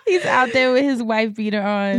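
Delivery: usual speaking voice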